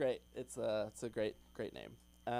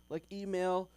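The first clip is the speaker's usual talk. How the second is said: normal talking voice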